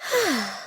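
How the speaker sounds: soft, tired exhale; soft sigh before a nap; weary sigh. Acted. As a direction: let out a drowsy sigh.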